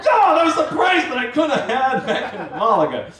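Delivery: overcharged traveler shouting in laughing disbelief; laughing outraged shout. Retold or imitated. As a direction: rant in comic outrage.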